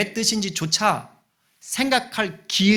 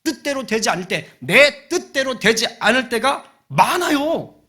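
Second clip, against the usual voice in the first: high, loud pulpit preaching; forceful, raised-voice sermon delivery; full-force preaching; 8 semitones higher, 8 dB louder than usual. Real, not acted. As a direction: preach it out loud, pitched well up.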